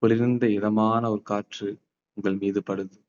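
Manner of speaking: slow, soft meditation voice; guided meditation. Acted. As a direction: paint the calm scene slowly. Guided visualization in park.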